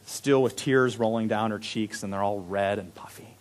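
ordinary delivery

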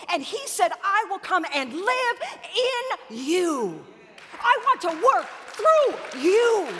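high, loud climactic preaching; full-force revival sermon climax; fiery preaching. Acted. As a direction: preach it to the rafters, building.

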